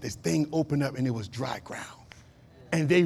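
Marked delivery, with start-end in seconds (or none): 0.0-1.9 hushed whisper of wonder at the miracle; awed whisper. Acted. Pastor whispers to the congregation in awe. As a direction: breathe it like a secret.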